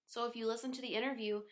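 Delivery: usual speaking voice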